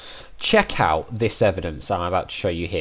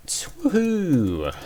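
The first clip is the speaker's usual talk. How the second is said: high, delighted whoop; excited cheer; 10 semitones higher than usual. Real, not acted. Player cheering after getting the warrant, high whoop.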